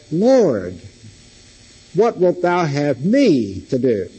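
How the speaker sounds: "me" stretched and lifted in "have me to do"; slow question leaning hard on "me"; stress on me. Shown as a demonstration. Preacher quoting Paul's question to the congregation, stress on "me". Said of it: "put the emphasis upon different words".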